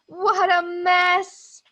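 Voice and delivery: hammed-up dismay at the muddy monkeys; slow exaggerated what a mess; mock dismay. Monkeys fall in mud.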